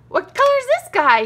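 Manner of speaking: high, loud thrilled question; excited. Real, not acted. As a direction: gasp and light up. Gasp then excited question.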